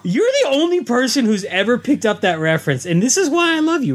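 high-pitched, gushing delight. Real, not acted. Host thanking co-host for catching the reference, high and delighted. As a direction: gush it high to the one who got the joke.

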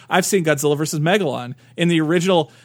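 ordinary delivery

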